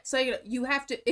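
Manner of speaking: usual speaking voice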